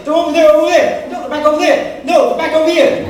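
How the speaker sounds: high, loud frantic co-pilot calls; Goose from Top Gun. Retold or imitated. Goose calling out enemy jets in Top Gun, high and frantic. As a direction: bark the bearings in a rush. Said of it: "Goose was going over, say".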